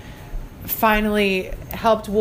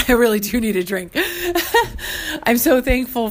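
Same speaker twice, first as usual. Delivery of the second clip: teary. Real, not acted. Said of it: "I'm not crying".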